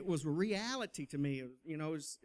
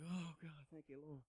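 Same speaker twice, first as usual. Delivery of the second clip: relieved under-breath thanks; 14 dB quieter than usual. Retold or imitated. Relieved when grandmother is called.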